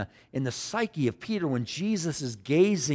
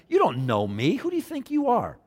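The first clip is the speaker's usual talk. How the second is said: indignant, leaping high on the question; sarcastic challenge; 6 semitones higher, 4 dB louder than usual. Acted. Nathanael challenging a stranger who claims to know him, sarcastic. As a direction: throw it back, scoffing and incredulous. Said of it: "even the sarcasm in his voice".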